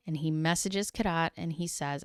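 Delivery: ordinary delivery, nothing marked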